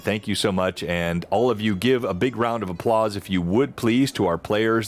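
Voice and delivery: ordinary talk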